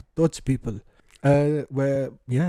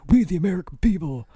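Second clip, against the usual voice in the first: higher-pitched mock patriot; patriotic American; 5 semitones higher than usual. Describing how corny Americans are, mock-patriotic voice. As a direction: proudly proclaim it as a parody American. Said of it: "They're very patriotic".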